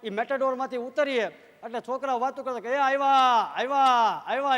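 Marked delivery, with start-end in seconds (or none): 2.7-4.6 loud, eager announcing; excited kids calling out. Retold or imitated. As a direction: shout it like kids spotting the troupe. Village kids announcing the artists. (ગામના છોકરા ઉત્સાહથી બૂમ પાડે છે)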